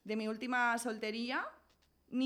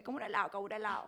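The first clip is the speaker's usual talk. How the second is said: usual speaking voice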